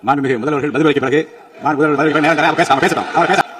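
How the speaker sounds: loud, insistent call over the house; real, forceful ruling from the chair; calling for order. Real, not acted. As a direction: raise your voice to restore order.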